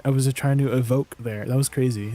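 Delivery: normal talking voice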